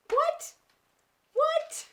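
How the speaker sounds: very high squeaky disbelief; stunned what. Real, not acted. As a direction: squeal it in shock. Reacting to sister reveal.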